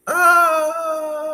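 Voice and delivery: sudden high yelp of falling; a short, loud cry as the step gives way; startled scream. Retold or imitated. Falling through the broken stair, loud startled scream.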